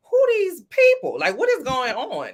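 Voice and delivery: high, drawn-out disbelief at the start; baffled reaction. Retold or imitated. Recalling her first episode of the show, baffled. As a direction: act out being thrown by these strangers.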